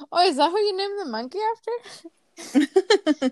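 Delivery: lightly voiced naive friend; ditzy innocent question. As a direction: play it sweet and clueless. Voicing Phoebe's innocent question.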